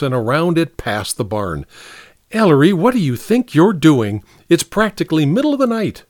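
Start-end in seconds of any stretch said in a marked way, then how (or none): 2.3-6.0 higher-pitched exasperated demand; incredulous, pitch climbing at the end; indignant scolding. A narrator's reading. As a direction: scold someone for disturbing your sleep. Scolding him for chopping at dawn.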